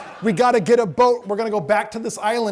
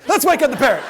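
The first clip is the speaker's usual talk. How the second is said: higher, louder showman call; loud host cue; 5 semitones higher, 4 dB louder than usual. Retold or imitated. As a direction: announce it to the room. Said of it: "I said".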